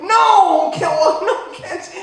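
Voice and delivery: high, loud shout of sudden panic, breaking into a laugh; panicked inner scream. Retold or imitated. His brain hearing the diagnosis, in a loud panicked shout. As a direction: yell it like you just heard you're dying. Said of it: "your brain just goes".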